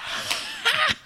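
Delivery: very high squeaky giggle; high-pitched laugh. Retold or imitated. Imitating the coach's high-pitched laugh. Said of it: "he's like".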